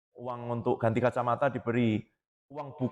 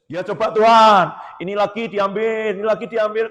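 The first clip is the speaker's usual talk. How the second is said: loud, higher taunting challenge to God; Satan's loud challenge; 8 semitones higher, 10 dB louder than usual. Retold or imitated. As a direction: goad God into testing Job. (Setan menantang Tuhan dengan suara keras dan mengejek)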